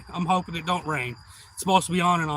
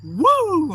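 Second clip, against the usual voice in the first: high, loud "woo" cheer; big celebratory whoop; excited whoop; 12 semitones higher, 9 dB louder than usual. Retold or imitated. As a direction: whoop it up, reliving the thrill. Retelling own excited cheer.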